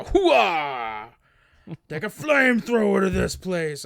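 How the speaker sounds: loud gung-ho soldier. Retold or imitated.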